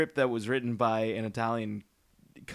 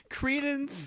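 high deflating delivery; 13 semitones higher, 3 dB quieter than usual. Retold or imitated. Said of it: "gave up halfway through".

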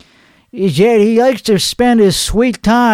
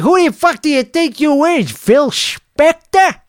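mock tough retort; 7 semitones higher than usual. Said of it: "he'd be like".

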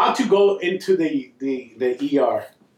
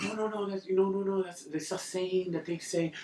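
soft, high, hurried-sounding apology; small, raised voice stumbling over no's; backtracking orderly imitation; 4 semitones higher, 12 dB quieter than usual. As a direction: imitate someone meekly taking it back.

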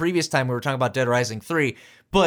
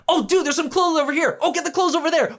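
excited; 9 semitones higher than usual. Retold or imitated. Acting out distracted play.

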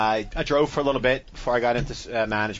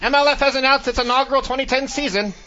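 put-on high, loud old-time newsreel announcer; old newscaster; 8 semitones higher, 4 dB louder than usual. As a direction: boom it out like a wartime newsreel. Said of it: "old newscasters".

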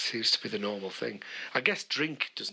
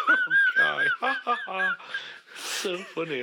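much higher, louder, spelled-out ha ha ha; sarcastic mock laughter; 7 dB louder than usual. Mocking Guy's potato joke, high, loud and sarcastic. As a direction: sneer at the joke with a fake laugh.

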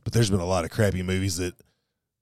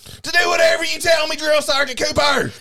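recruit shouting; 6 dB louder than usual. Acted. Recruit answering drill sergeant.

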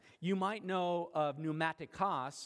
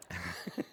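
short, low laugh; low chuckle; 10 semitones lower, 3 dB quieter than usual. Real, not acted. Preacher after his payday joke, amused low chuckle. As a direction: chuckle at your own joke.

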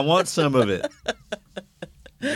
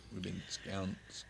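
very soft, halting mumble; mumbling; 3 semitones lower, 21 dB quieter than usual. Real, not acted. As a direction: trail half-formed words under your breath. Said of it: "What are you mumbling about over there?".

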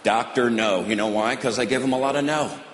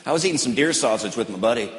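ordinary talk

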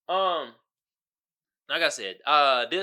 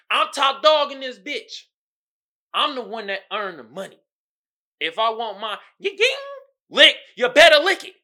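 Monique boasting; 7 semitones higher, 10 dB louder than usual. Retold or imitated. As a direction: lay down the law as the breadwinner. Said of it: "she was like".

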